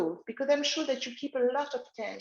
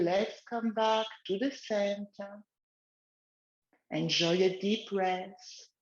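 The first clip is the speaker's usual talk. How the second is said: guided relaxation; 2 semitones lower than usual. Acted. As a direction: lead gently, eyes closed. Leads the exercise again.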